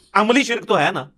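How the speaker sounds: ordinary talk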